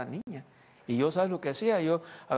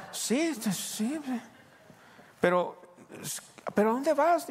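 eager curiosity; 9 semitones higher, slower than usual. Retold or imitated. Wife eagerly asks husband where he is going. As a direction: press him excitedly.

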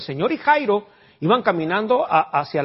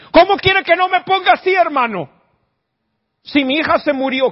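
high, loud anguished protest; upset grieving father; 10 semitones higher, 6 dB louder than usual. Pretend. Grieving father snapping back at comforter, high anguished protest. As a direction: throw the comfort back in their face. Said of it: "que te dijera Jairo".

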